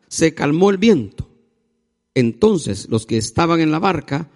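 projected, deliberately phrased Bible reading; solemn scripture reading. A narrator's reading. Preacher reading the Gospel to the congregation, declamatory. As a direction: read it out to the whole church in measured phrases. Reads the Bible passage aloud.

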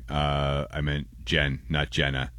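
ordinary delivery

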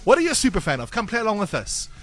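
ordinary delivery

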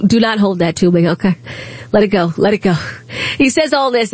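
normal talking voice

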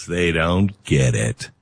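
low, slow dismissive aside; dismissive young generation. Acted. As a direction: drop low and slow, writing off the clueless elders. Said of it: "allows her generation to go".

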